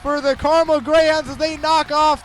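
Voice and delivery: full-throated victory call over the crowd; ecstatic winning call. Real, not acted. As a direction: explode as the shootout is won. Winning penalty, team advances.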